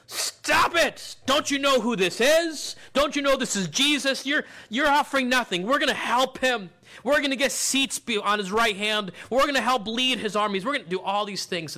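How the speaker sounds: high-pitched, heated scolding; indignant rebuke. Retold or imitated. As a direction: tell off a noisy nobody as an indignant crowd. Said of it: "So they rebuke him".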